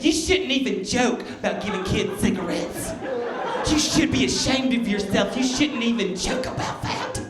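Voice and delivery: high-pitched imitation of an offended complainer; mock indignant scold. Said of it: "People get mad at that one too".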